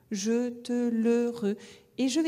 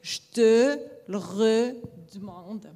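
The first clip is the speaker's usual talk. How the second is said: slow, louder «je te le redemande», TE held long; exaggerated gestural delivery; 4 dB louder, slower than usual. Shown as a demonstration. Teacher playing with gesture to mark the phrase. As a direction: act it out with big gesture. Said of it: "je joue avec la gestualité".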